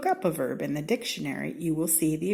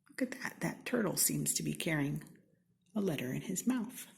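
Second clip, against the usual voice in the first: softer, almost a whisper, pointing something out; hushed; 7 dB quieter, faster than usual. Acted. Narrator to children, quietly pointing at the turtle, hushed. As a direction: draw them in quietly to the picture.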